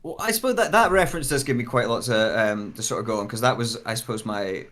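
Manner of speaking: usual speaking voice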